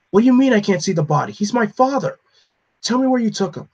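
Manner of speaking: high, loud and fast outrage; angry demand. Acted. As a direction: fight for his father's body. Terry demands his father's body.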